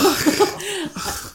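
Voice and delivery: high bursting laugh; burst of laughter. Real, not acted. Laughs at being called on.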